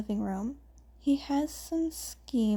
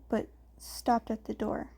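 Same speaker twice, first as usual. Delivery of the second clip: usual speaking voice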